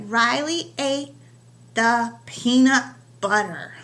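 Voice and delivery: slow, each sound stretched out; sounding out a sentence slowly for young writers. Shown as a demonstration. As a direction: stretch every sound like a snake. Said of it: "remember to say it slow".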